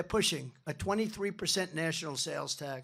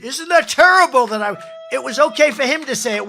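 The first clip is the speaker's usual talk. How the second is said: much higher, much louder mocking yell; loud, high sendup of shocked reporters; mock press outrage; 7 semitones higher, 15 dB louder than usual. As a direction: bellow the reporters' fake outrage. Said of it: "They said".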